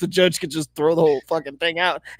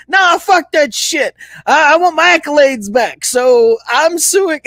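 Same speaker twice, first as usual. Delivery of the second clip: brash Les Miles; 6 semitones higher, 4 dB louder than usual. Retold or imitated. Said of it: "at the end of the fucking day".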